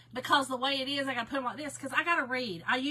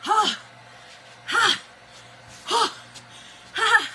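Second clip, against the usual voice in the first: loud, high panicked gasps of "Ha!"; panicked gasping cries; 10 semitones higher, 9 dB louder than usual. Retold or imitated. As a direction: gasp loudly for air in terror. Retelling panic gasps on waking.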